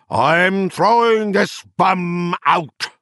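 rolling, carrying voice. Acted. Arberg announcing to the party, rolling and carrying. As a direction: boom it to the whole hall. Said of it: "In a rolling, carrying voice".

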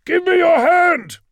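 high, loud, drawn-out plea; urgent full-voiced rescue cry; desperate shout. A narrator's reading. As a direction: yell it to someone slipping out of reach. Rescuer shouts to trapped lord.

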